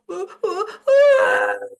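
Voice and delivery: high whimpering wail rising to a loud cry; mock toddler crying. Retelling how the scared mom's child starts crying, loud wail. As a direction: imitate a toddler bursting into tears.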